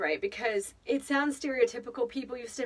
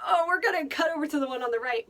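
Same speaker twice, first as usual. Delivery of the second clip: excited aside; 6 semitones higher, 5 dB louder, faster than usual. Retold or imitated. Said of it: "we're like".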